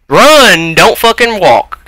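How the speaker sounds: yelling at the sluggish character. Real, not acted. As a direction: push harder on the second try. Repeats the order louder.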